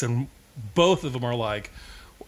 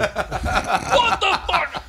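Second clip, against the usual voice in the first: normal talking voice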